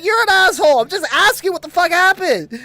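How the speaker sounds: high, loud imitated comeback; indignant yell. Kid arguing back at mom, retold indignant yell. Acted yelling match with mom.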